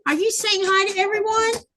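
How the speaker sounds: playful high pet voice; talking to the dog. Real, not acted. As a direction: coo and tease the pup.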